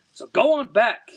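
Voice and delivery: defiant fisherman yelling. A narrator's reading. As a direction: shout it after the sinking serpent. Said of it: "yells Charlie".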